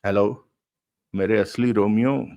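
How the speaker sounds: ordinary delivery, nothing marked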